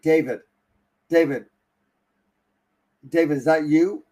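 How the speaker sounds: loud retold shout of "David" into the dark; shouting a name into the night; calling out loud. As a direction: call your buddy's name across the camp. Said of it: "Then I yell out".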